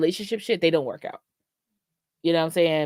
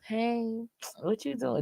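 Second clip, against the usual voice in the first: soft sweet greeting; 6 semitones higher, 9 dB quieter, slower than usual. Retold or imitated. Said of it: "they're not the".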